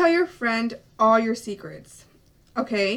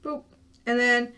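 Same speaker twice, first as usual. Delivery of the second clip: usual speaking voice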